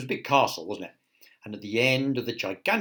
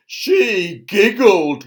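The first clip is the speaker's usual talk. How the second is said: loud, raised, drawn-out outrage; appalled cry; 8 semitones higher, 14 dB louder, much slower than usual. A narrator's reading. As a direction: wail it as a scandalised king. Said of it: "went on the king".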